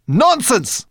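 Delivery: high, loud angry outburst. Acted. Brownie girl furious at the guide, loud and high. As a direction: snap it back. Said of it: "turned on him angrily".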